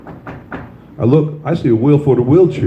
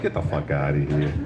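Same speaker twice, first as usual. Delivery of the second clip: low, soft mock disbelief; dismissive retort; 10 semitones lower, 10 dB quieter than usual. Comedian retells brushing off the man at the mall, low and dismissive. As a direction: brush him off, low and unimpressed.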